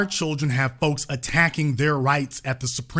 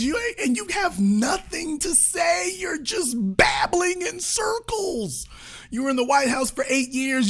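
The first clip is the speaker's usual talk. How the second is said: much higher, incredulous mock yell; exasperated rant; 11 semitones higher than usual. Ranting at the couple about their empty podcast, much higher and incredulous. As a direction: vent in disbelief at them, pitched way up. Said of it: "I'm like".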